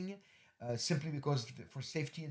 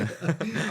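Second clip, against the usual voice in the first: brief laugh, louder and higher; a quick happy chuckle; short laugh; 6 semitones higher, 10 dB louder than usual. Real, not acted. Laughs again.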